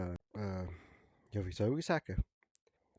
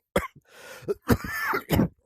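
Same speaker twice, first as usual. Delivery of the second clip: loud cough; 9 dB louder than usual. Acted. Barber has a cold.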